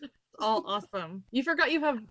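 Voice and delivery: usual speaking voice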